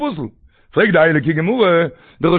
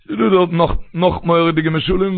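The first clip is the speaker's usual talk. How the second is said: usual speaking voice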